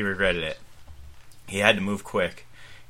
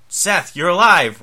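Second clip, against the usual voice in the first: slow, high, loud joyful cry; exclaiming; 10 semitones higher, 9 dB louder, slower than usual. Acted. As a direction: burst out in relief. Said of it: "Ryan exclaimed".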